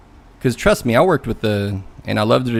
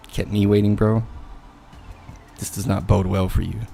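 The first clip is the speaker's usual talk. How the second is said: mildly haughty disapproval; understated acted condescension; looking down his nose; 4 dB quieter than usual. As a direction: let them know they are beneath you. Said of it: "now I'm looking down my nose like".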